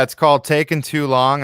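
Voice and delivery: ordinary talk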